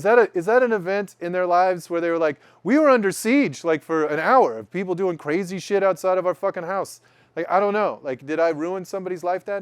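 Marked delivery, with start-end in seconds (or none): 2.6-7.0 higher, louder aggrieved homeowner voice; imagined victim. Pretend. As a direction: play the rattled family member. Imagining the family's frightened account.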